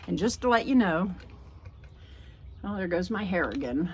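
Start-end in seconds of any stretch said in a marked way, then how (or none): none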